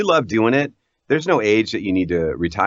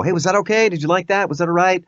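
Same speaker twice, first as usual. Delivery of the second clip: higher, eager checking questions; approval-seeking; 8 semitones higher than usual. Acted. Actor fishing for approval after a take, needy. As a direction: ask anxiously for approval, pitched up. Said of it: "always looking for that approval".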